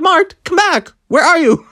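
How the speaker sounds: high, pleading call after someone leaving; calling after him. Retold or imitated. Girls calling the guide back, high pleading call.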